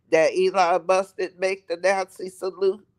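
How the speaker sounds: normal talking voice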